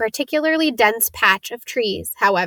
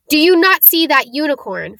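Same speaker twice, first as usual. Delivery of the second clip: raised, higher and louder challenge; bellow; 5 semitones higher, 4 dB louder than usual. A narrator's reading. Firenze bellowing at Bane over the dead unicorn. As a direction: bellow it at him. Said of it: "Firenze bellowed at Bane".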